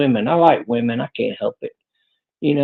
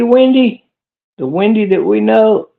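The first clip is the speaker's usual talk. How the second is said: surprised; 6 semitones higher than usual. Real, not acted. Double-takes on the name.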